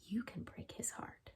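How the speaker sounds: unvoiced, secretive whisper; conspiratorial whisper. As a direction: whisper it into her ear like a scheme. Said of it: "Miss Havisham whispers in her ear".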